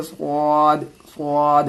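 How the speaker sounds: स्वाद said thick with rounded lips; heavy swad. Shown as a demonstration. Teacher demonstrates heavy स्वाद to students. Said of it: "इसको मोटी करके बोलनी है, पुर करके".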